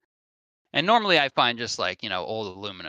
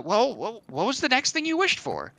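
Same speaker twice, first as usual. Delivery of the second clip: eager question; 11 semitones higher than usual. Acted. Guy asks eagerly.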